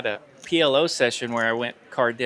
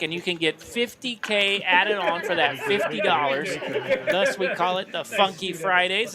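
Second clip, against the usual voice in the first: upbeat, higher announcer promo; promo read; 4 semitones higher than usual. Acted. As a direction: sell the tournament like an ad. Casino tournament promo read.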